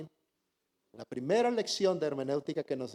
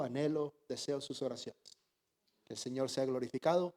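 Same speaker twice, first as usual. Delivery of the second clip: ordinary talk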